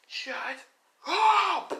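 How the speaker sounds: high yell bursting on the last word; acted furious high shout; exploding anger. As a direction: scream it, building to a loud shriek. Anger boils over at taunts.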